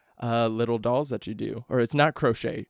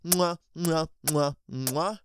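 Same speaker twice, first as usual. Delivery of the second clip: blown kisses; 4 semitones higher, slower than usual. Real, not acted. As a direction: blow kisses to the listeners. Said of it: "let me go ahead and kiss you guys too".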